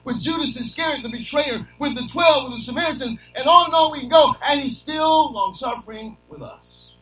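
high, loud, fiery preacher cry; preaching at full force. Acted. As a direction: drive it home to the congregation, shouting. Preacher building to a shout.

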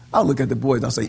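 usual speaking voice

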